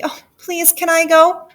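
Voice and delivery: high-pitched plea; pleading like an eager child; eager begging cry. Acted. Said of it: "cried Gertie".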